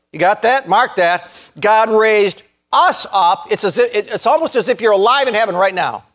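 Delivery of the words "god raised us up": higher, with each word stretched; slow, emphatic. Real, not acted. Preacher repeats the verse for listeners to mark, slow and emphatic.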